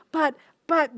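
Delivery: Cinderella's stammered protest, acted out. Cinderella protesting to godmother in story.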